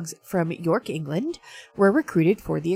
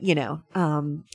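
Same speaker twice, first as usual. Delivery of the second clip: ordinary delivery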